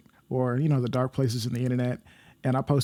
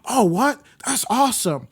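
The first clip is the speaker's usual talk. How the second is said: high, excited mock gushing; enthusiastic supporter; 8 semitones higher, 4 dB louder than usual. Gym acquaintance praising the podcast project, excited. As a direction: imitate an eager, loud cheer of approval.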